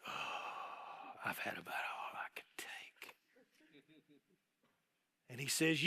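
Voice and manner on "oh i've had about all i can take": breathy exhausted whisper, fed up; worn-out whispered complaint; weary whisper. Retold or imitated. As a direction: sigh it out at the end of your rope.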